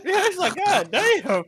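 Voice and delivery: laughing disbelief. Real, not acted.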